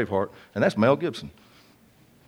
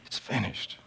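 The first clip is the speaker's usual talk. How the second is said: imagined cry, breathy and hushed; 13 dB quieter than usual. Retold or imitated. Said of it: "just screaming out".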